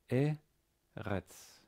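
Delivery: "Eretz" split slowly into E, then retz; sounded out. Shown as a demonstration. Teacher spelling out a Hebrew word, syllable by syllable.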